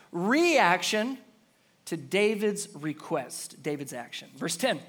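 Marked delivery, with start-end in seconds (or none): none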